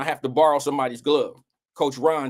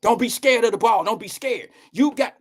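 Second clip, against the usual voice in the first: coach yelling instructions; 8 semitones higher than usual. Retold or imitated. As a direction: bark it like a youth baseball coach. Coach voiced in story.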